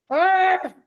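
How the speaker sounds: high-pitched frustrated "ah"; exasperated cry. Retold or imitated. Daughter fed up with mother's questions, exasperated high cry. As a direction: let out an acted cry of exasperation. Exasperated at having to ask father.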